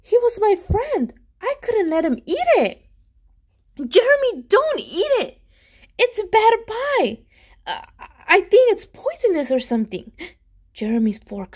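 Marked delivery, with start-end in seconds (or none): 0.0-2.9 high, louder, rising panic; panicked. A narrator's reading. Narrator boy panicking as his friend lifts the fork, high and rushed. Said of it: "I panicked". 3.8-10.1 high, loud, urgent cry to stop him; urgent warning. Acted. Boy yelling at his friend not to eat the pie, urgent and loud.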